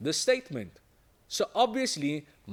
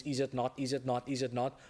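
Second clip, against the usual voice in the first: low, monotone run of repeats; rote repetition; 3 semitones lower, 5 dB quieter than usual. Shown as a demonstration. Teacher showing students not to reuse one tag constantly, rote repetition. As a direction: rattle it off by rote, lower.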